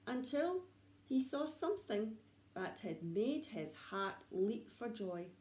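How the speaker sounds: ordinary delivery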